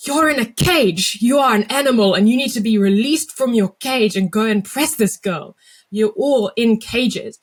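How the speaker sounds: loud, high South African coach voice; South African accent. Retold or imitated. Coach firing up his team in a South African accent. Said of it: "I'm allowed to do the South African accent".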